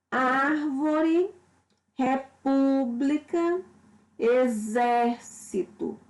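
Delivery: "Árvore, república, exército" slow, stretching ÁR, PÚ, ZÉR; stress on antepenultimate syllable. Shown as a demonstration. Teacher listing proparoxytone examples, slow stressed antepenultimate syllables. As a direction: lean on the accented vowel in "Árvore, república, exército". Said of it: "tendo como a sílaba tônica a antepenúltima".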